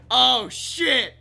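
loud shock. Real, not acted. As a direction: burst out with the reaction. Listener repeats reaction, now loudly.

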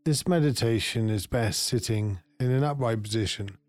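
calm meditation guide, long pauses between phrases; guided meditation. Acted. Meditation guide settling listeners into their peaceful place, guided meditation voice. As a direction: lead them gently inward, unhurried, leaving space after each phrase.

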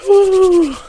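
big high whoop; excited whoop. Real, not acted. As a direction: let out a loud, high celebration cry. Hype cheer introducing number three.

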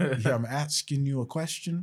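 put-on joking Southern accent; southern twang. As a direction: push the question with country swagger. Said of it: "slang and southern twang".

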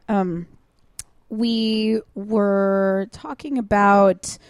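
ordinary talk, nothing marked

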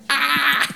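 high, sustained gotcha cry; triumphant "ah!". Retold or imitated. Storyteller hears his phrase on TV, acted triumphant cry. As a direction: crow a high "ah!" at being proved right. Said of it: "I was like".

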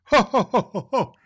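big booming jolly laugh, then sung; hearty Santa laugh rolling into song. A narrator's reading. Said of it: "Klaus Claus laughed".

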